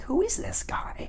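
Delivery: slow high whisper, barely voiced; whispered question. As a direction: breathe "Who is this guy?" in a frightened whisper. Whispering question in the stranger's house.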